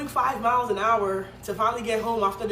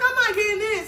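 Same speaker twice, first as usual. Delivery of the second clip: high and loud, final; stubborn refusal; 12 semitones higher, 6 dB louder than usual. Retold or imitated. To the driver, refusing to get in the car, high and loud. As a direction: plant your feet and refuse. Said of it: "So I'm like".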